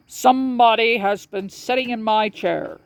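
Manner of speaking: a light take on the big bear, grumbling; great big bear. A narrator's reading.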